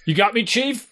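mock swagger, half joking; playing a tough coach, only lightly; tough guy.

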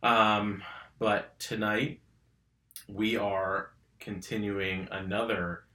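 normal talking voice

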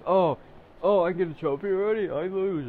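high, loud mocking whine; mocking whiny kid. Vlogger mocking kids who want trophies, high mocking whine. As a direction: sneer it like a spoiled kid who lost.

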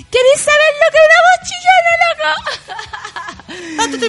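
squeaky voice. Pretend. Teasing Thompson in a high squeak, laughing. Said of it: "voy a leer así".

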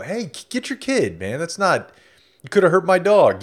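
half-yelled, hammed-up complaint; mock yelling. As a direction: act out telling off a parent, pitch jumping up. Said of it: "like, yell".

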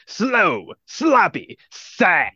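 drawn-out mocking taunt, each word set apart; sneering wolf's put-down; mocking villain. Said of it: "he's just like".

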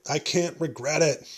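ordinary talk, nothing marked

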